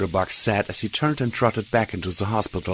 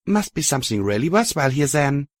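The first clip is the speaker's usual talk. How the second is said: light comment; 6 semitones higher than usual. A narrator's reading. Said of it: "I commented lightly".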